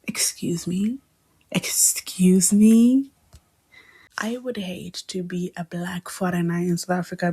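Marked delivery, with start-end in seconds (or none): 0.1-1.0 soft disbelieving "Excuse me?" with a rise on "me"; hushed and offended, "Excuse me?" rising; quietly appalled "Excuse me?"; indignant. Real, not acted. Indignant reaction to quote. 1.5-3.1 very slow, whispery "Excuse me?" stretched out with a rising "me"; exaggerated offended "Excuse me?"; drawn-out indignation. Real, not acted. Repeated indignant reaction.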